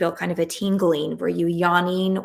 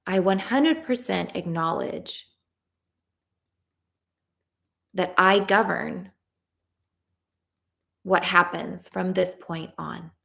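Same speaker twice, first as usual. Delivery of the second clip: tapping script. Acted. Coach leading a tapping round, calm guided voice. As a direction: lead the group through the affirmations calmly.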